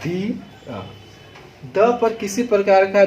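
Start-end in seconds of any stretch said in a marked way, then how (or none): 0.0-0.8 दिया said short and soft, no push on द; teacher models दिया lightly for pupils; unstressed demo. Shown as a demonstration. Teacher showing pupils a light, unstressed दिया. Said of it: "जब हम दिया का उच्चारण कर रहे हैं".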